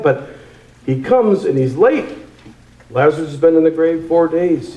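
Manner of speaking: ordinary delivery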